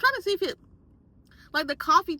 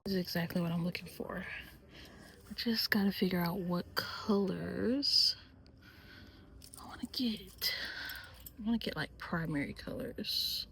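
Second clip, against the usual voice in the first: hushed whisper in a store; 6 semitones lower, 8 dB quieter than usual. Real, not acted. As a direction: keep it down, whisper.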